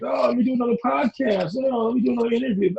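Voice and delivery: high, fast mock enthusiasm; mocking his eager self. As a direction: lampoon his own hunger for every podcast, quick on the second line. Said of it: "because I'm a ball hog".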